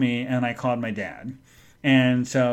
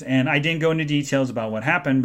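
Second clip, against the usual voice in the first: ordinary talk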